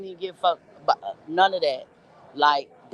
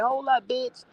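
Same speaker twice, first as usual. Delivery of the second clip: higher-pitched imitation of the angry junkie; mock junkie threat; 7 semitones higher, slower than usual. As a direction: call after her like a junkie with a grudge. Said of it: "He was like".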